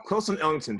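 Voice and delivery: ordinary talk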